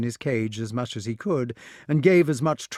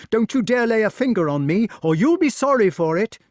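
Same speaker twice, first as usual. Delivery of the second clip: high, loud defiant threat; shouting boy; 11 semitones higher, 7 dB louder than usual. A narrator's reading. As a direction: yell it hanging upside down. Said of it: "cried the wart".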